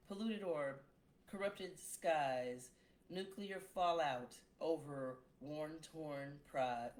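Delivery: slow, declamatory verse; dramatic poem reading. Acted. Poet performing her epic poem, slow and declamatory. Reads long sci-fi poem.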